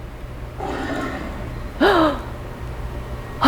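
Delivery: long breathy scared gasp; fearful gasp. Acted. Teacher gasping in mock fear for children. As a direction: gasp as if something is lurking.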